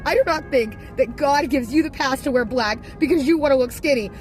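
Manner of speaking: high, loud mock outrage; indignant protest. Retold indignant argument with grandma.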